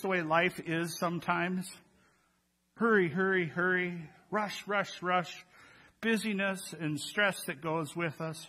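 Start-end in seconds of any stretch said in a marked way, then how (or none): none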